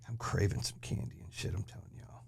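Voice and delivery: breathy unvoiced whisper to the audience; whispered aside. Real, not acted. Host whispering a candy craving to viewers. Aside while lowering the mic.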